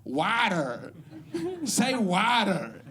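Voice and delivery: mock American saying water; American accent. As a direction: imitate Americans asking for the word water. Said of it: "People would come up to me afterwards and go".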